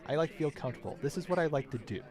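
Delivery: ordinary talk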